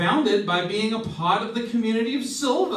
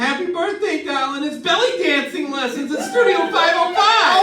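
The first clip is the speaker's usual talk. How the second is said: high, loud and bubbling over with a laugh; excited birthday reveal; 10 semitones higher, 7 dB louder than usual. Acted. Friend unveiling a birthday surprise, high and loud with excitement. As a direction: announce the surprise gift like it's the best news ever.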